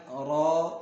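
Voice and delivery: را rounded toward "raw", held; thick را. Shown as a demonstration. Teacher models heavy letter, را thick and held. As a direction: stretch را with a heavy, full vowel. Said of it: "را کو موٹا ہی پڑھنا ہے".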